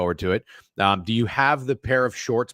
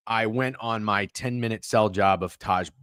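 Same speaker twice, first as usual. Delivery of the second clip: usual speaking voice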